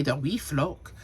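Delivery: mild attempt at a friend's accent; badly imitated accent, only lightly put on; Ruth's accent. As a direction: have a light, clumsy go at her accent. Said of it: "Awful accent".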